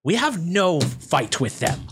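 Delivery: measured goblin reprimand; goblin camp leader. Acted. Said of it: "the bugbear looks confused. He says".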